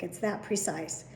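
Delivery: usual speaking voice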